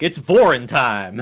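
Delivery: loud, drawn-out mock proclamation; catchphrase announcement. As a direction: announce it big, stretching the words. Imitating a character's catchphrase entrance.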